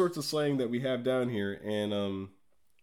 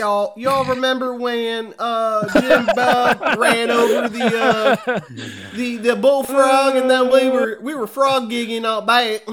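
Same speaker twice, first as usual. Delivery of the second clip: put-on ridiculous Southern accent; 11 semitones higher, 10 dB louder than usual. Mocking the wedding speech of a sister-in-law, ridiculous Southern accent. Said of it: "freaking ridiculous Southern accent".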